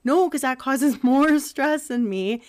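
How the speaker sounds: retorting to doctors through a laugh; laughing protest. Retold or imitated. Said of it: "I'm like".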